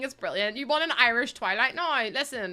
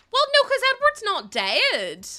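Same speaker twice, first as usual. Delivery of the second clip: much higher and slower, scandalised; high exasperated; 8 semitones higher, 7 dB louder than usual. Real, not acted. Streamer correcting chat about Edward, scandalised squeal.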